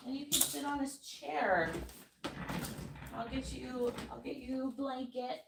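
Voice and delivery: soft, hushed talk to the cat. Real, not acted. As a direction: coax the cat gently and quietly.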